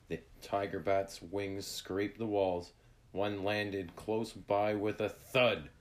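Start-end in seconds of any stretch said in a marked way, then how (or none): none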